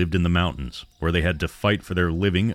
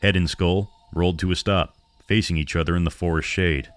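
ordinary delivery

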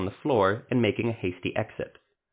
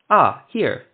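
bright, raised cheerful exclamation; 6 semitones higher, 8 dB louder than usual. A narrator's reading. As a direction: say it brightly, pleased to find it. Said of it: "the halfling said brightly".

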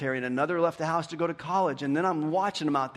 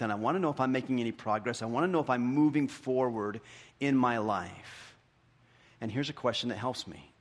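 usual speaking voice

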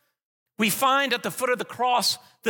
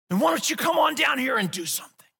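defiant challenge; 5 semitones higher than usual. Acted. Believer voiced shaking fists at God, defiant challenge. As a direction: shake your fist as you say it. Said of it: "we defiantly shake our fists at God".

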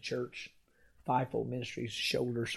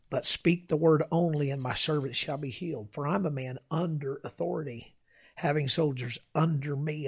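scripture reading; 2 semitones higher, 4 dB louder than usual. Acted. Preacher reads the centurion's words of faith to the church, rising preaching voice. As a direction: proclaim the verses to the congregation.

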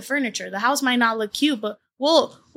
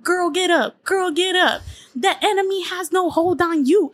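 motivational speaker; 7 semitones higher than usual. Retold or imitated. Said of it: "this little motivational speech".

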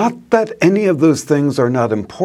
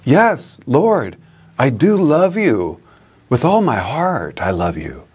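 insist, then soften; emphatic. Retold or imitated. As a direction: Peter insisting to Jesus that he loves him. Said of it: "Peter responds more emphatically".

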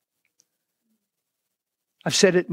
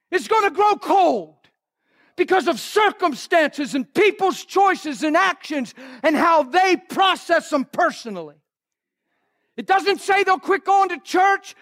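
high, impassioned preaching voice; fiery preaching; 11 semitones higher than usual. Acted. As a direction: preach it out to the church at full force. Preaching at full force.

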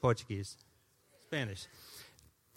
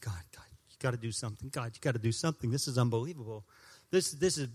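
quiet desperate prayer; 5 semitones lower, 7 dB quieter, faster than usual. Retold or imitated.

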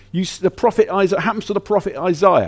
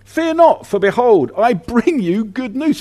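higher, louder herald announcing; angel's proclamation; 6 semitones higher, 4 dB louder than usual. Retold or imitated. Angel to the shepherds, ringing higher and louder. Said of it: "And the angel said to them".

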